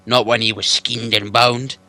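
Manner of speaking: loud, slow menacing troll; troll Bert. Acted. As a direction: growl the dare slowly. Troll reply.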